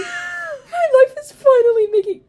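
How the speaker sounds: high loud, laughing-crying yell; excited shout. Real, not acted. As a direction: shriek it in delight.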